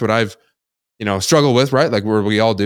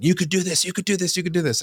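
mock yell; 11 semitones higher than usual. Sales president screaming encouragement at him, a high mock yell. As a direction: pitch it way up like a coach firing you on. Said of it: "screaming at me".

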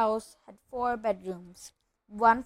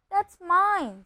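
higher-pitched, possessive objection; indignant protest; 8 semitones higher, 6 dB louder than usual. Acted. Boy whose letter was snatched, indignant. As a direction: protest that it belongs to you.

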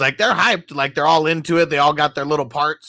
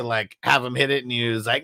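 ordinary talk

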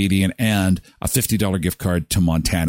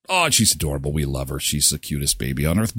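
ordinary talk, nothing marked

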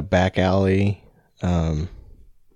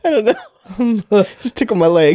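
laughing; 13 semitones higher, 3 dB louder than usual. Real, not acted. Hand joke laughing.

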